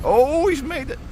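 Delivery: high-pitched amazed "oh"; real spectator's surprise, pitch jumping up; pleasant surprise. Real, not acted. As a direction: react as he unexpectedly makes it. Watching van squeeze past, surprised.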